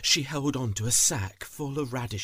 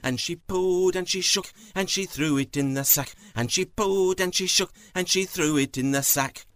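fast, loud, higher rhythmic chant; hurried refrain; 6 semitones higher, 5 dB louder, faster than usual. A narrator's reading. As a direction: race through it with a driving beat. Thief hurriedly pulling radishes in refrain.

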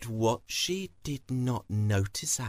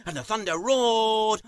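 high, loud storyteller's cry; thundering narration; 9 semitones higher, 6 dB louder than usual. Acted. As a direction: belt it out like the storm's climax. Storm climax, leads into roar.

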